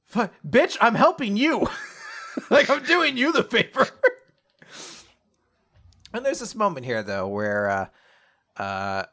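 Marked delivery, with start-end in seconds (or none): 0.1-3.9 high, loud exasperation cracking into laughter; hammed-up incredulous complaint; mock indignation. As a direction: protest as if wronged, amused. Acted indignant reply, laughing.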